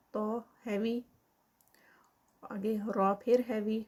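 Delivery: ordinary delivery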